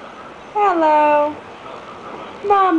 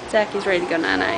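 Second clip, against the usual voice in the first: ordinary delivery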